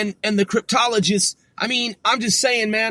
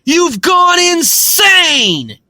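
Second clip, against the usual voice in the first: slow, louder, higher accusation; slow angry verdict; 4 semitones higher, 5 dB louder, much slower than usual. Real, not acted. Telling Britain it has gone insane, slow angry shout. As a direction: drag each word out in disbelief.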